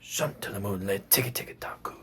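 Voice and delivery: whispered, very soft chant; pianissimo. Shown as a demonstration. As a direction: whisper the syllables very softly.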